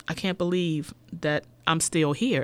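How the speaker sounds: ordinary talk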